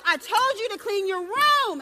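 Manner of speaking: loud, slow scolding mother; exasperated mother yelling at her son; upset mom. Acted.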